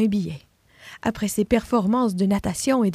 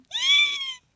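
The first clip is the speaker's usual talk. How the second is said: high little cry of fright; tiny frightened squeak. Acted. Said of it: "le léger cri d'effroi".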